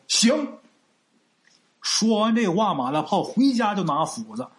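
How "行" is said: high, bright-eyed one-word yes; beaming, delighted. A narrator's reading. Ma Dapao accepts the chief's promise, delighted. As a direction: jump at the deal gleefully. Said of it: "眉开眼笑说".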